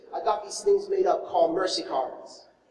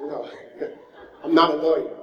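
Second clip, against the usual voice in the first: laughing denial; 6 semitones lower, 3 dB louder than usual. Retold or imitated. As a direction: laugh through the no, then answer amused. Pastor laughing at the mixup.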